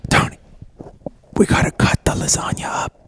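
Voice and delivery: unvoiced conspiratorial whisper. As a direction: hush it to your assistant as a secret. Chef whispers to assistant.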